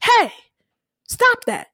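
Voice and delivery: building to a high shout; mock yell. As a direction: order him to stop. Tate yells to stop beating.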